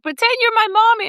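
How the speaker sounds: put-on very high little girl voice; child voice. A little girl asking her to play mommy, high-pitched. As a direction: squeak it like a small child. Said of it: "She was like".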